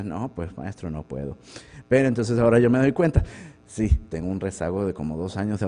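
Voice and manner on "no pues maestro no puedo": softer, lower sheepish reply; meek excuse. Retold or imitated. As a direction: mumble the apology like a caught student. Profesor se excusa ante bibliotecario. (excusa tímida y apagada, más baja y suave)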